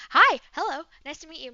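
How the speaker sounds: high, loud burst of hello, easing off; bubbly hyper greeting; bouncy, excited. Acted. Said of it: "starts bouncing up and down and's like".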